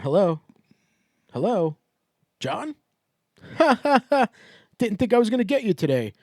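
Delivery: chirpy fake-friendly caller, pitching up; mock robocall voice. Imitating a robocall from the PBA for a friend, chirpy and fake-friendly. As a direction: play the cheery recorded caller. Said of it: "On the other end, you're going".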